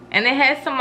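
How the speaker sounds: usual speaking voice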